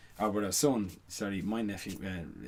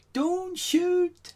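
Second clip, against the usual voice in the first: put-on much higher, louder shout of a small Northern Irish boy; little boy shouting; 7 dB louder than usual. A small boy in a silent crowd calls out during the gun scene, in a much higher, louder Northern Irish child's shout. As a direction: cry out high like a frightened little Belfast boy. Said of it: "little boy just shouts out in a Northern Ireland accent".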